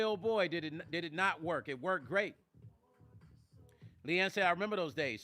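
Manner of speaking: ordinary delivery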